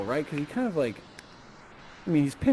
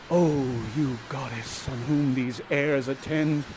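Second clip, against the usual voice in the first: slow, lower awestruck lover; gasp, then let it out slowly; Ferdinand; 4 semitones lower, slower than usual. Acted. Ferdinand takes a look at this beautiful young lady, slow hushed awe. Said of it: "and he goes".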